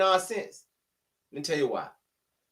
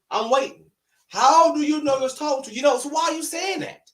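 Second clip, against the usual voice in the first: rising heated challenge; 7 semitones higher, 4 dB louder than usual. Real, not acted. Vlogger challenges commenters who assume, building to an indignant shout. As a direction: pitch climbing into an indignant shout.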